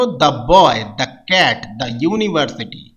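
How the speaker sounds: the said "da" before consonants. Shown as a demonstration. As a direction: open "da" for the, not "dee". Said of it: "দি এর উচ্চারণ হবে দা".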